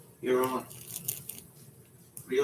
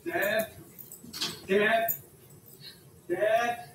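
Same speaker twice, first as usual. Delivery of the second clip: raised, searching call, last words drawn out; 7 semitones higher, 6 dB louder, much slower than usual. Acted. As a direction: call out for Dad, high and loud. Calling out for a missing parent.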